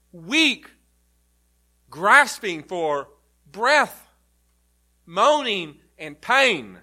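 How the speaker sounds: slowing and swelling on the dying thief; preaching at full force. Acted. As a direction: drive the point home to the congregation. Preacher builds to climax.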